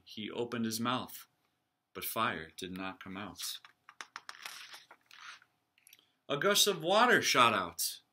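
lively read-aloud for the class; storybook reading. A narrator's reading. Teacher reading a picture book aloud to her class. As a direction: read it like story time for kids.

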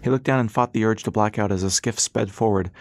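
ordinary delivery, nothing marked

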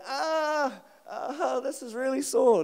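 high groans of mock agony; acted pain. Handcuffed man hurting in the ambulance, acted moaning. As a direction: wail lightly, imitating being in pain.

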